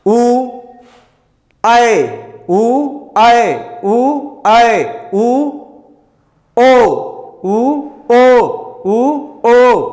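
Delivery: slow, stretched vowels ऊ, आए, ओ; vowel demo. Shown as a demonstration. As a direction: sound out each vowel for the class to copy. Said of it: "बोलिए उ".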